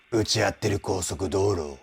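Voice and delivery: low, hushed chest voice with a light buzzy rasp. Retold or imitated. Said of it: "僕が[name]の声真似をするとこういう感じになる".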